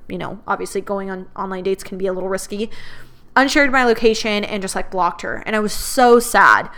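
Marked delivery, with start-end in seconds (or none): none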